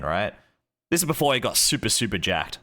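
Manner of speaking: ordinary delivery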